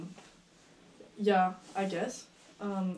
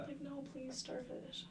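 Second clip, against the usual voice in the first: whispered higher plea; soft pleading; 5 semitones higher, 16 dB quieter, slower than usual. Real, not acted. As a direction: half-whisper please, starfish.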